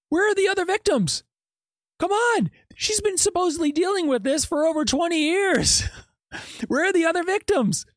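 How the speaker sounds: high-pitched, incredulous challenge; exasperated disbelief. Real, not acted. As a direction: demand it high, with a scoffing laugh midway. Challenges the alter story, exasperated.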